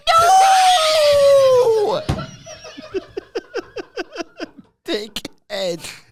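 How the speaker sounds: very high, loud laughing shriek; mock shriek. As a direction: shriek through laughter, then mutter the insult. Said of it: "No, I'm saying".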